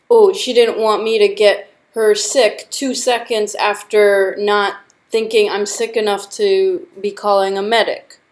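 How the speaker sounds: ordinary talk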